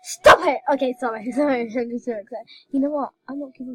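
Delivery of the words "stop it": sudden shout. Real, not acted. Shouting at friend teasing her.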